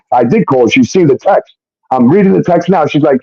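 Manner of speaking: usual speaking voice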